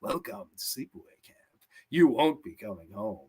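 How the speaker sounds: sinister trailer voice for a slasher movie; trailer guy voice. Retold or imitated. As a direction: breathe it out like a horror trailer tagline. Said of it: "That trailer guy voice".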